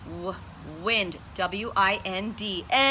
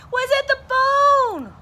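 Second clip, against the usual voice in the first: high, loud holler; hollering dog; 9 dB louder than usual. A narrator's reading. Dog hollering up at the bird. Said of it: "Rocket hollered".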